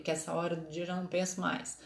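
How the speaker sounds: ordinary talk, nothing marked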